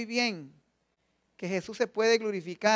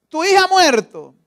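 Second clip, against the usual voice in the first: voice of the enemy; 7 semitones higher, 11 dB louder than usual. Acted. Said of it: "La voz del enemigo".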